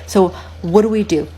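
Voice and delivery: usual speaking voice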